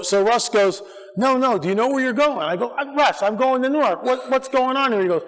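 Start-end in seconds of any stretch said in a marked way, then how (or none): 1.2-2.4 higher, insistent quote; imitating an eager caller pressing the point; retold insistent friend. Friend presses the speaker on the phone, insistent. 2.8-5.0 higher, bewildered quote; retold puzzled protest. Speaker answers his friend on the phone, puzzled. Voices himself puzzled on the call.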